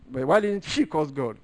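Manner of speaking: usual speaking voice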